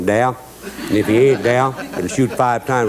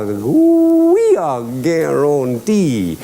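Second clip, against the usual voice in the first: drawn-out delight; much slower than usual. Retold or imitated. Delight at ducks again.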